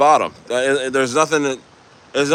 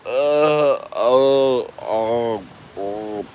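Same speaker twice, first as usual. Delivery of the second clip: drawn-out sleepy groans; acted grogginess; much slower than usual. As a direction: mimic being dazed after waking. Acting groggy when just woken.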